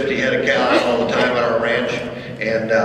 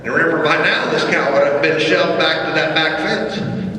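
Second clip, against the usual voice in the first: normal talking voice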